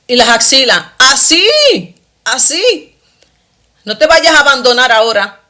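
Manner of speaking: hammed-up nagging sister warning her off; mocking older sister. Sister scolding her new faith.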